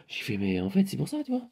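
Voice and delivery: ordinary talk, nothing marked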